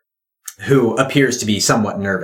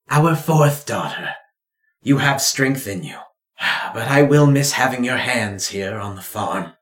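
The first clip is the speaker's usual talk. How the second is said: lightly raised tender mother voice; a touch of wistful pride; proud mother. Acted. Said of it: "She goes".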